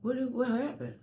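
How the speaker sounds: a lightly voiced sassy inner friend soothing her. Her inner personalities telling her to calm down, sassy and high. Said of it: "My other personalities in my head".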